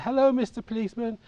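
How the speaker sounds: put-on high, hopeful little-boy hello; imitating himself as a small boy after a coin; child's eager greeting. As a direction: pitch it up, sweet and expectant. Boy greets officer hoping for money.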